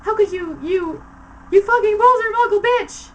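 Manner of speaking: high loud enraged yell; shouting. A narrator's reading. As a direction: scream the insult in fury. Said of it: "he shouted".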